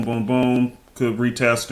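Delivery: ordinary talk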